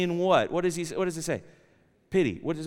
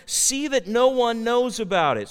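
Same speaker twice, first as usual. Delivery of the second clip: raised, emphatic scripture warning; higher, louder stern charge; 5 semitones higher, 6 dB louder than usual. A narrator's reading. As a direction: read it as a firm, loud command. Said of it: "Jesus sternly warned them".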